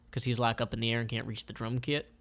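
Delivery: plain reading voice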